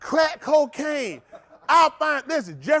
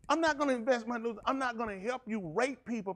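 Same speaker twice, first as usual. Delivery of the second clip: usual speaking voice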